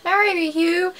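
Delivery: high-pitched mock fan scream; retold scream. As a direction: re-enact yelling a proposal to a star, held back in volume. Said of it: "So I might have screamed out".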